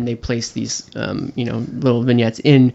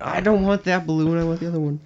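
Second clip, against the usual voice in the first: put-on higher fussy kid voice; whiny child; 4 semitones higher, 4 dB quieter than usual. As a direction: whine like a picky toddler.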